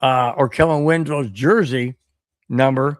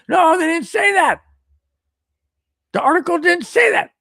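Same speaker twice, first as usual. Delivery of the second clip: exasperated correction; 12 semitones higher than usual. Real, not acted. Host correcting the article's wording, higher and almost shouting. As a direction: throw your voice up high and insist they never said it.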